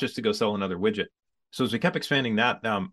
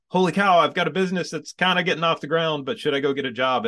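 mock panic; 7 semitones higher, 4 dB louder than usual. Retelling panic about triplets.

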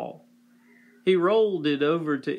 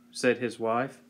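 ordinary delivery, nothing marked

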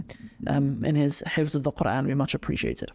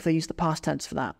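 usual speaking voice

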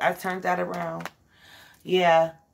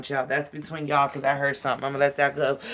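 ordinary delivery

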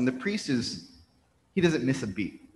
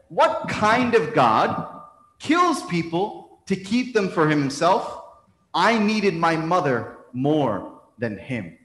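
slow, raised, emphatic challenge, easing toward the end; acted anguished accusation; 4 semitones higher, slower than usual. Bereaved son accuses God before a priest, anguished protest. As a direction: start high and forceful, drag out every phrase.

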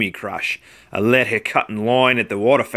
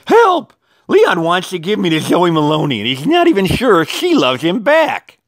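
cry for help; 7 semitones higher than usual. A narrator's reading. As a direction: yell for rescue like a cartoon. Valentine cries for help.

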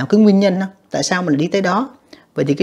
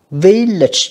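"Village" loud on VIL; first-syllable stress; 3 semitones higher, 5 dB louder than usual. Shown as a demonstration. Teacher showing students word stress, VIL-lage with the first syllable stressed. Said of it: "âm một nha các bạn".

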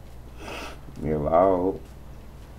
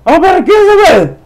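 much higher and louder, fast screeching; high-pitched furious screech; frustrated screech; 14 dB louder, faster than usual. Real, not acted. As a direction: scream it in exasperated mock rage. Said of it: "I can't hardly talk like that".

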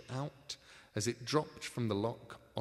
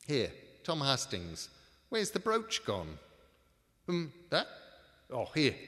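suspicious crook voice; 4 semitones higher, 6 dB louder than usual. Acted. Bolger accusing his partner.

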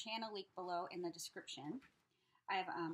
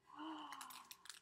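high, soft gasp; delighted gasp; 5 semitones higher, 9 dB quieter than usual. Real, not acted. Seeing beautiful journaling pages, delighted gasp. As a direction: draw in breath in admiration.

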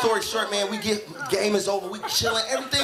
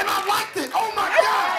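high, hammed-up dismay; appalled at himself, pitched up; mock-horrified confession; 9 semitones higher, 3 dB louder than usual. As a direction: wail the embarrassing admission.

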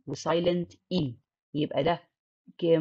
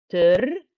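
تر said alone and louder; isolated second syllable; 7 dB louder than usual. Shown as a demonstration. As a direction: punch تر out as its own beat. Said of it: "أدي تاني مقطع". (تر كمقطع منفصل بصوت أعلى)

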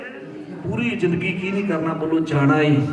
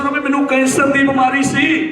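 higher, raised voice for repeating; loud declaration; 7 semitones higher, 4 dB louder than usual. Shown as a demonstration. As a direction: proclaim it for her to echo. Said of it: "ਉੱਚੀ ਬੋਲ ਕੇ ਦੱਸੋ". (ਉੱਚੀ ਆਵਾਜ਼ ਵਿੱਚ ਐਲਾਨ)

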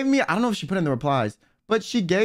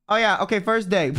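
ordinary talk, nothing marked

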